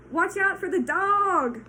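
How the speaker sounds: loud, high, slow sheep cry; sheep crying a warning. Acted. Sheep warning Wilbur about the dog, loud. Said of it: "cried the sheep".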